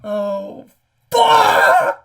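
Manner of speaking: a real shocked yell; shocked curse. Real, not acted. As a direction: blurt it out in alarm. Player reacts to failed spell.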